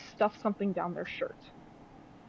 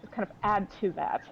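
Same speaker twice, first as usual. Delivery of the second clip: ordinary talk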